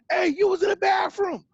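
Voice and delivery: put-on high, loud accusing yell at a kid; a retold lady shouting high and loud; lady's accusing shout. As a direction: call him out like a suspicious store lady. Lady catches him in store.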